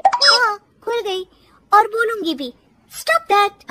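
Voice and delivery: high, cheeky retort; defiant daughter-in-law. Acted. Daughter-in-law defies mother-in-law.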